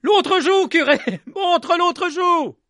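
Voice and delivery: high, loud jeer, slowing at the end; mocking yell. A narrator's reading. Villager taunting the slapped priest, mocking yell. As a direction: taunt him cruelly. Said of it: "crier à nouveau".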